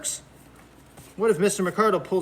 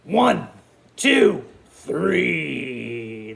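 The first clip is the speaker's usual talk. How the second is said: crocodile count; 3 semitones lower, much slower than usual. Pretend. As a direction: bite out each number, drop the last. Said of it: "He's gonna go".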